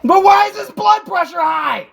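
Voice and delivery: heated, insistent challenge; angry demand. Real, not acted. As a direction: fire the question back, exasperated. Heated family quarrel.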